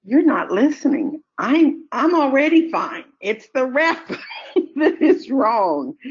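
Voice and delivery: put-on exasperated kid. Scene: a boy telling the comforting adults they miss the point, high exasperated. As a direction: play the indignant boy who's already fine. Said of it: "It's like".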